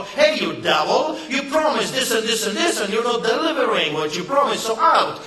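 mock angry call-out. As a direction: throw the devil out, loudly. Citizens calling out a politician.

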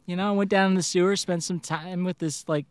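ordinary delivery

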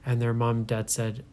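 plain reading voice

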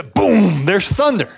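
ordinary delivery

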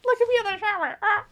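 high giggly mock glamour; laughing. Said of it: "I wasn't trying to be sexy".

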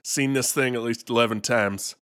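lightly played Kentucky ghost exterminator accent, unfazed; a hint of a Kentucky old-timer who's seen it all; Kentucky twang, laid-back and sure of himself. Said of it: "but you did the accent".

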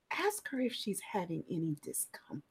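whispered tip to a colleague; hushed, unvoiced aside. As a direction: lean in and whisper the suggestion. Leans over to the nurse.

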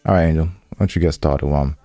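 ordinary talk

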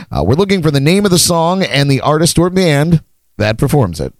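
upbeat host welcoming live viewers; game show host. Acted. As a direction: invite everyone in like a show host.

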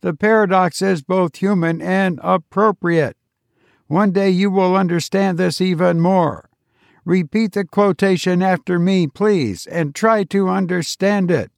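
robot teacher. A narrator's reading. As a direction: lecture like a patient machine.

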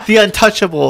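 mock grandeur. Real, not acted. Mocking the councilman.

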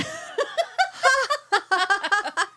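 long high burst of laughter. Real, not acted. Co-host bursts out laughing at the ending. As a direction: laugh out loud at the twist. After reading funny story.

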